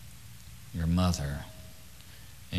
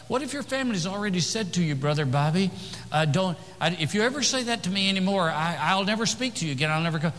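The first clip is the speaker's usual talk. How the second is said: quoting a questioner; 10 semitones higher, faster than usual. Retold or imitated. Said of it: "Somebody said to me".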